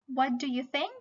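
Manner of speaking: ordinary talk, nothing marked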